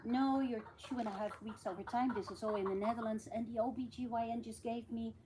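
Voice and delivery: usual speaking voice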